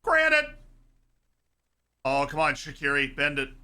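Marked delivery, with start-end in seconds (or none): 0.0-0.6 high, loud cry of the name; excited shout. Real, not acted. Streamer reacting to the Swiss player, excited call.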